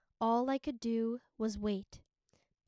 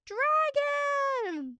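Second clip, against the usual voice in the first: high, loud panicked screams; terrified screaming; 6 dB louder than usual. A narrator's reading. Servants screaming as the dragon wakes, high and panicked. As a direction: shriek it out in terror. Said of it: "all the people were yelling".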